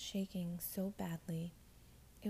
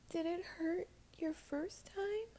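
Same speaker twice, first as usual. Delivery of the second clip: timid; 11 semitones higher, slower than usual. Acted. Nervous girl asks.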